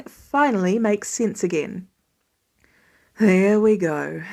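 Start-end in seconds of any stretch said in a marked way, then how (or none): 3.2-4.2 slow, relieved, a touch lower; sigh of relief. A narrator's reading. Dad finally untangling the sling, slow and relieved. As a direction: let the relief out as you say it. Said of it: "with a sigh of relief".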